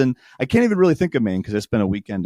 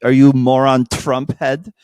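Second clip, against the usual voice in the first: slow, higher, louder mock French taunt; French bartender; 4 semitones higher, 5 dB louder than usual. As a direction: tease him like a French barmaid. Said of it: "She's like".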